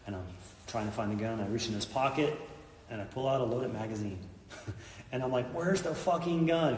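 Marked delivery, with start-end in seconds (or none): 5.5-6.8 high, loud, slow alarmed demand; panicked demand for the gun; retold panic, higher and louder; freaking out. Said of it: "'cause I'm freaking out now".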